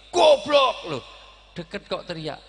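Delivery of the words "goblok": high, explosive mock-angry yell; angry shout. Shown as a demonstration. Preacher shows how angry people must shout, demo yell. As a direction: bellow the insult at full blast. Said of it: "Harus teriak".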